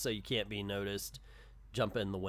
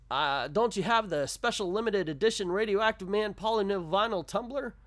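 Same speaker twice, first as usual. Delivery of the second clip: higher-pitched customer read, rising at the end; lightly voiced eager customer; 9 semitones higher, 4 dB louder than usual. Kid asking the shopkeeper for a collectible, lightly voiced customer. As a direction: ask it high, as a comic-strip customer. Reads comic customer's request.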